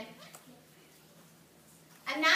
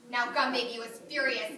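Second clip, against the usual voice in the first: ordinary delivery, nothing marked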